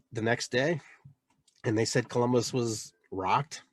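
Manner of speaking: ordinary delivery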